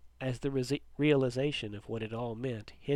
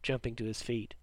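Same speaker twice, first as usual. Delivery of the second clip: plain reading voice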